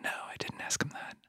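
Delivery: whispered, small and guilty; sheepish whisper. Retold or imitated. Younger self admitting a missed question, whispered. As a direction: confess it in a tiny whisper. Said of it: "And then I'd be like".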